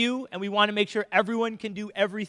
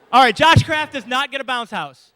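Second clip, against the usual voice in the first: ordinary delivery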